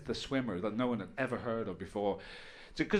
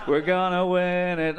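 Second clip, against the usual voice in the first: loud, drawn-out, higher boast; mock cocky fans; 5 semitones higher, 7 dB louder, slower than usual. England fans sure of victory, mock cocky boast. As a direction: stretch it out like a boasting fan. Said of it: "people going".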